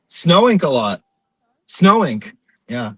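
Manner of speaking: imitated chatty Portuguese-American woman. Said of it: "if you're Portuguese, it's very close".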